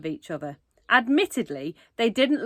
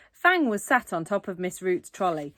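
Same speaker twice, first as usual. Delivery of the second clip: ordinary delivery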